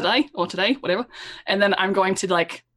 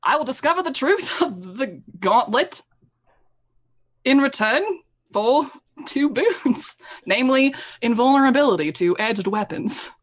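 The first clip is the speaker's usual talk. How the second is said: paladin oath with deliberate pauses; 3 semitones higher than usual. Acted. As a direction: declaim a vow, phrase by phrase. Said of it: "I love your paladin voice".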